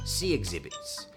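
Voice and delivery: ordinary delivery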